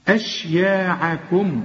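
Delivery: slow أشياعكم with thin vowels throughout; all letters light. Shown as a demonstration. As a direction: recite أشياعكم slowly, thickening nothing. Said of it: "ما فيهاش ولا حرف مفخم".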